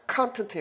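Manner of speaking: 컨텐트 with the stress on the first syllable; CONtent, noun. Shown as a demonstration. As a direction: say 컨텐트 as KAN-tent, the first syllable strong. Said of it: "강세가 앞에 떨어집니다".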